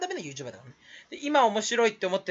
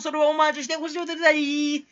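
ordinary delivery

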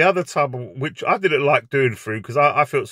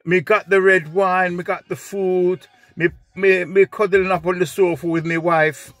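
put-on Jamaican accent; 5 semitones higher than usual. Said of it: "he goes".

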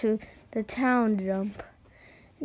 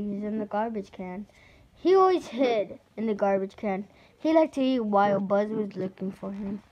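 plain reading voice

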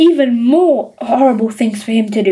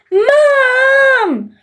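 very high crying scream; 11 semitones higher than usual. A narrator's reading. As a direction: scream for mother in tears. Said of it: "screamed Peter".

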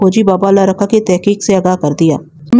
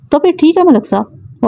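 decisive; 7 semitones higher than usual. A narrator's reading. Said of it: "وہ فیصلہ کن انداز میں بولا".

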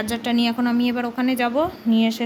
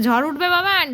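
louder, higher call to her son; 6 semitones higher, 6 dB louder than usual. Real, not acted. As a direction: call across the house to Babaan. Calling out to son. (ছেলেকে জোরে ডাকা)

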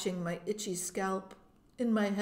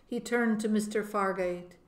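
ordinary delivery, nothing marked

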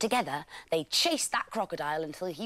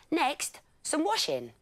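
bossy lions; 13 semitones higher, 5 dB louder than usual. A narrator's reading. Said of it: "said the lions".

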